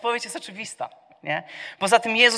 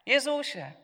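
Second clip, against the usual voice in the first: a higher, mild call; 7 semitones higher than usual. Acted. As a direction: call his name, not yet shouting. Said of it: "Wołał". (wysokie, łagodne zawołanie)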